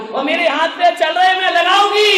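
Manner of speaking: loud, high complaint; complaining shout. Retold or imitated. Mother-in-law shouts her complaint about her son to the daughter-in-law.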